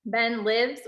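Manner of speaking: normal talking voice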